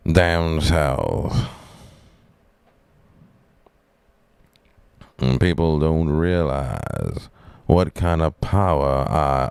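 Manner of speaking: put-on Southern drawl with stretched endings; Southern accent. Boasting of power down south, mock Southern drawl. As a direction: play a slow-talking Southern dealmaker. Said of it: "what Southern".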